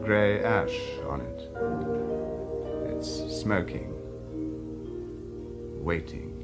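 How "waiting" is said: ordinary talk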